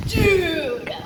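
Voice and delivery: imitating the friend admiring the car; excited buddy. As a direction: gush about the car, half laughing. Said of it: "you're like".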